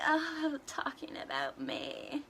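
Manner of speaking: drawn-out "oh" into slow whisper; anxious whisper. Retold or imitated. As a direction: whisper it slowly, acting out paranoid thoughts. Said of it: "I'm like".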